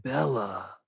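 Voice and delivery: low, soft, deadpan mock Edward; emo excitement. Edward greeting Bella, low and soft in deadpan emo mockery. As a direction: sigh her name with no excitement at all. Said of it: "his excitement is hilarious because it's also emo".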